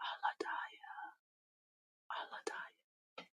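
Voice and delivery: soft slow whisper of the name. Real, not acted. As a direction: breathe the name, slowly.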